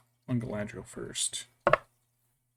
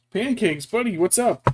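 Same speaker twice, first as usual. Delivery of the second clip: high, loud pet-talk voice; calling sweetly to the bird; 9 semitones higher, 7 dB louder than usual. Real, not acted.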